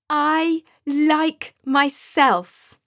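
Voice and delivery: slow, high, loud triumphant cry; joyful shout. A narrator's reading. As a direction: shout it slowly at the top of your voice, full of joy. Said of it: "shouted Joseph at the top of his voice and with joy".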